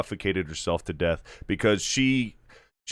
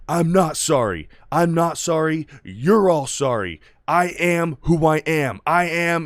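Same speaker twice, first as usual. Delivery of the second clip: higher, louder angry outburst; losing it; 7 semitones higher, 5 dB louder than usual. Retold or imitated. Homelander grabbing the mic at the party, angry loud outburst. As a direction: rant it like you've lost control. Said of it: "Homelander loses it".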